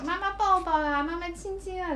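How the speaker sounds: put-on high, slow sweet child's plea; cutesy whiny pleading. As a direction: coax like a clingy little girl. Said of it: "开始撒娇".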